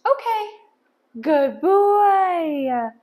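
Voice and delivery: loud, high, delighted release and praise; happy dog-trainer cheer; excited praise. Real, not acted. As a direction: praise the dog with big, drawn-out enthusiasm.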